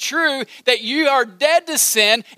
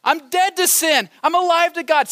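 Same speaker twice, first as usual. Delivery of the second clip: higher, shouted declaration; sudden shout; 8 semitones higher than usual. Acted. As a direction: burst out with it. Preacher suddenly shouts creed.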